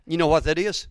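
normal talking voice

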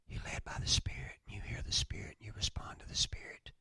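hushed whisper, drawing them close. Real, not acted. Pastor drawing the congregation close, whispering. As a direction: breathe the words in awe. Intimate climax of sermon.

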